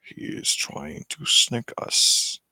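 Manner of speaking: slow, whispered villain aside; hushed. Acted. Villain hushing his sidekick in the background, slow whisper. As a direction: whisper it slowly, keeping hidden.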